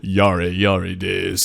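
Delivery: mock cool anime hero; anime catchphrase.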